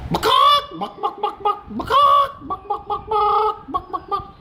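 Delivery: high clucking chicken noises with a laugh. Pretend. Teacher voices chicken in story.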